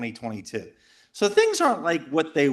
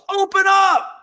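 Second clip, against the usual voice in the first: much higher, louder barked "Open up!"; retold agent's yell; 8 dB louder, slower than usual. Speaker, reenacting the agent at his door, yelling. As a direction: bark it like an agent pounding the door. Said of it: "Person yells".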